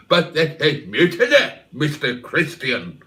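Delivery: a hint of a stern old ship's captain; Charles Laughton impression. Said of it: "my best Charles Laughton impression".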